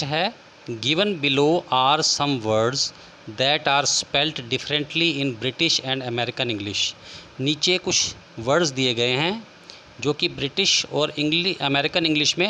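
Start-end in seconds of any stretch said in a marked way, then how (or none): 0.7-6.9 slow dictation. Shown as a demonstration. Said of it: "teacher reads exercise instruction slowly".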